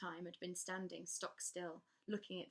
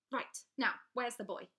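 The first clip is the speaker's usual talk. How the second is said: loud, higher barked question; gruff demand; 5 semitones higher, 8 dB louder than usual. Acted. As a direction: demand it, impatient. Crow demands the boy.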